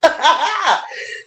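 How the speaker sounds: breathy high-pitched giggle. Real, not acted. Streamer giggling at her own success, high breathy laugh. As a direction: giggle with delight, almost voiceless.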